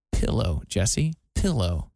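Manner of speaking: pillow with a firm short I, slow and low; low, spaced-out teaching of pillow; friend's correction. Retold or imitated. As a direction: correct someone with a deliberate "PILL-oh" for pillow. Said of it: "that said".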